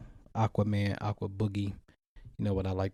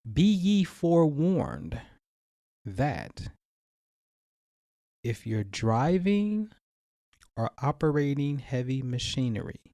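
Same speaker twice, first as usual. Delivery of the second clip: theatrical forewarning, pitched up and spaced out; dramatic warning; 4 semitones higher than usual. Pretend. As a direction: intone it like a grand disclaimer, drawing out the words. Mock-solemn production warning before visualization.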